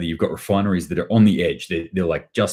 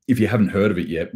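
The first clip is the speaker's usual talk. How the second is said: ordinary talk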